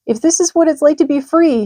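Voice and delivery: a small worried pig thinking aloud; Wilbur the pig. A narrator's reading. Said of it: "he thought".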